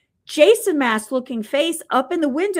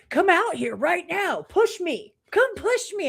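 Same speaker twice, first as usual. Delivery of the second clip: mock ghost hunter challenge; 6 semitones higher than usual. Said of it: "they're like".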